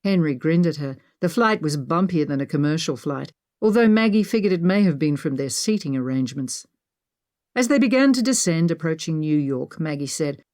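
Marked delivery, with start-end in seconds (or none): none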